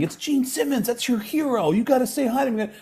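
imitating a girlfriend in a high, light voice; nagging girlfriend. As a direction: urge him on in a put-on high voice. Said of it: "his girlfriend keeps saying".

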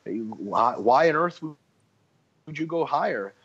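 normal talking voice

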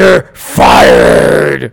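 booming mock shout; mock firing shout. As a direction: bark it out like a TV boss. Firing co-host as a bit.

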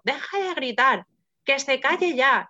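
mock the gynecologist barking at her to shut up; retold angry snap. Gynecologist snapping at the screaming patient, angry and stern. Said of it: "me gritó".

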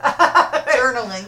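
slow, loud drawn-out journaling. Acted. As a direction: stretch the word out for comic effect. Exaggerated repeat of topic.

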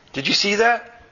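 high, eager question; sudden excitement. A narrator's reading. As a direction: urgently point out a startling sight. Ond suddenly notices the glitch.

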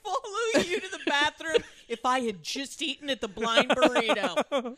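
loud, high and breaking into laughs; comic outrage through laughter; laughing mock rant. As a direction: crack up while playing indignant. Joking echo of the rant, laughing.

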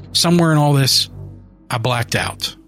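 usual speaking voice

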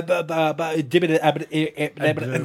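stuttering cartoon character impression; Porky Pig. Friend showing his cartoon impression, stuttering. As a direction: sputter broken syllables like a cartoon. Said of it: "this is my Porky Pig".

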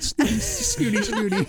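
high-pitched snooty echo through laughter; mock snooty. Echoing co-host's administrator bit, laughing mock snootiness. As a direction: giggle out a playful snooty squeak.

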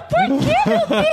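high-pitched comic despair; hammed-up exasperated plea; mock exasperation. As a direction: wail it in playful frustration. Acted exasperation in comic story.

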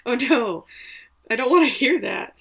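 dreading the follow-up news; dismayed oh no. Real, not acted.

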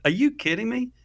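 high-pitched, incredulous retort; genuinely offended. Retold or imitated. Panelist answering woman from L A, offended and incredulous. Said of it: "like, genuinely offended".

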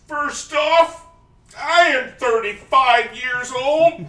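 hammed-up shouty character quote, slowing on the age; SNL sketch character. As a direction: boom it out like a washed-up life coach. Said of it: "one sketch on Saturday Night Live".

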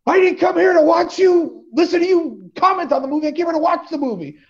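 retold shout. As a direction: shout down a talker in the theater. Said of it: "stood up ... and went".

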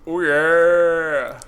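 long drawn-out mascot bellow; Kool-Aid Man. Acted. Host showing the one-line impression, Kool-Aid Man voice. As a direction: stretch out the catchphrase as a cartoon mascot. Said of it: "one-dimensional impression. He just goes".

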